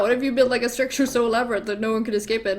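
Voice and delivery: fast, run-together proposal; acted rapid re-pitch; hurried repeat. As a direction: rattle the idea off quickly. King hurriedly repeats the same idea.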